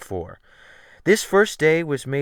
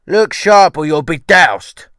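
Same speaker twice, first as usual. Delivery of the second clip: loud, slow rough Cockney cook; ship's cook calling after the boy, loud and rough; cook's warning; 6 semitones higher, 15 dB louder than usual. Acted. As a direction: bark it at the cabin boy. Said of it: "was Mister McGridge's parting injunction".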